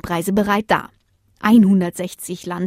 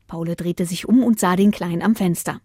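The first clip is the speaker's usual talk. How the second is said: plain reading voice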